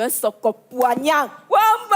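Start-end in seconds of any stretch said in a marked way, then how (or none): 1.5-2.0 high-pitched shout; shouted exclamation. Acted. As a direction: yell it out with glee. Gossip influencer exclaims.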